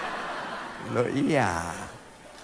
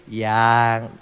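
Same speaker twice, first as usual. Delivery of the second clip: full of affection; 8 semitones lower than usual. Shown as a demonstration. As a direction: let "Yang" carry tenderness. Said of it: "Intonasinya itu na-, penuh nada kasih".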